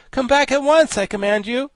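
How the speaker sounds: very high, loud panicked command; frightened cry. A narrator's reading. Tip commands the flying Thing from the chimney, frightened cry. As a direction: order it back in fright. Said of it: "cried Tip in a frightened voice".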